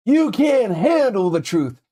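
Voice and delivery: high, slow, drawn-out movie outburst; film line impression. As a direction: belt it out slowly like a dramatic film quote. Acts out famous film line.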